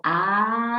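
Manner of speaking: long rising chant ending in a laugh; aloha laughter. Shown as a demonstration. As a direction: draw the word right out, then burst into ha-ha. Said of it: "So it looks like this".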